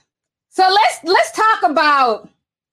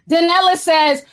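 ordinary delivery

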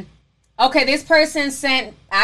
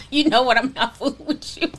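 laughing. Real, not acted. Laughing while reading a chat.

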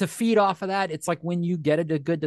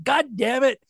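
high-pitched mock cursing; mock exasperation; 8 semitones higher, 3 dB louder than usual. Imitating audience annoyed by the joke, mock exasperation. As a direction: curse like a fed-up listener, playfully. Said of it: "lost half our audience because they're like".

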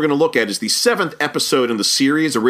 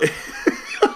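breathy high giggle; airy high laugh; 4 dB quieter than usual. Real, not acted. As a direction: giggle under your breath. Laughs at forgotten name.

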